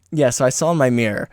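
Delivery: ordinary delivery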